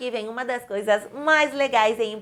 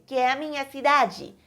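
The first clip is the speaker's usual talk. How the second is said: ordinary talk, nothing marked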